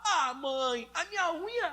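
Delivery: put-on a kid fussing over his nail; whiny child. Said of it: "o Felipe lá".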